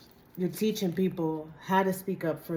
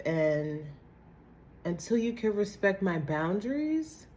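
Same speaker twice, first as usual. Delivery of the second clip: sassy brush-off; 5 semitones higher than usual. Retold or imitated. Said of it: "a sense of family that is like".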